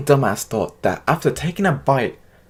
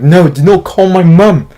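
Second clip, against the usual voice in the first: loud, slow, higher yell stressing NOT; frustrated sick kid yelling at the babysitter; 6 semitones higher, 9 dB louder, slower than usual. Retold or imitated. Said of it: "I'm, like, yelling at the babysitter".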